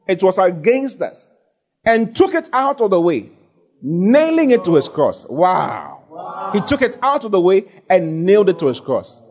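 high-pitched, climbing sermon delivery; full-force preaching. Real, not acted. Preacher proclaiming to congregation the ordinances nailed to his cross, full-force preaching.

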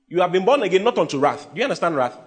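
normal talking voice

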